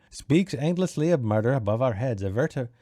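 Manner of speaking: odd, theatrical character voice; hammed-up weird storybook talker; Kroop. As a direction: declaim it with lilting, swooping pitch. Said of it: "the voice I did for that guy".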